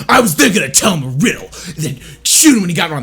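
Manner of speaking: loud, high villain voice; proud, forceful cartoon-villain delivery; confident Riddler. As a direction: boast of the evil plan as if you truly believe it. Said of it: "he sounds very confident".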